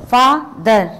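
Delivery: FATHER broken in two, FA - THER, with a gap; split by syllables. Shown as a demonstration. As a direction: say father slowly and forcefully as two separate beats, fa... ther. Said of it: "दो चीज़ों को मिलाकर बोला जाता है".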